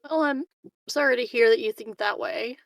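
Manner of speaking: ordinary talk, nothing marked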